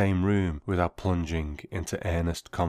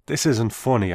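angry; 5 semitones higher, 6 dB louder than usual. A narrator's reading. Angry at the prank caller.

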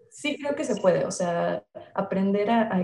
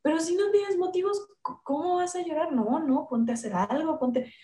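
impatient mother; 7 semitones higher than usual. Retold or imitated. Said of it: "su primera reacción es".

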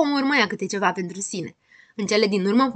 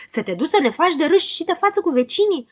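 high, outraged question; roaring; 8 semitones higher, 4 dB louder than usual. Acted. Friends dread being shamed before neighbours, roaring. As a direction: raise the pitch in scandalised protest. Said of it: "răgniră cu toții".

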